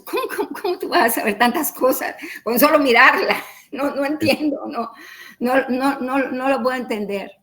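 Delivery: a touch of laughing disbelief at the news; stunned disbelief. Retold or imitated. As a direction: replay the shock, incredulous and quick. Retelling her shock at the doctor.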